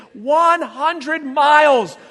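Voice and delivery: one hundred miles, slow and loud; one hundred miles stretched out in mock outrage; emphatic. Real, not acted. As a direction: hammer every word of one hundred miles. Mocking storm panic.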